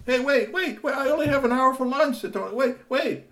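high, loud pleading calls; high panicked Tony. Acted. Tony protesting as the car speeds off, pleading. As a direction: call after him to wait.

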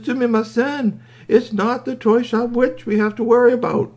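very high, anxious toy voice; urgent squeaky toy. A narrator's reading. Upset toy warns the boys.

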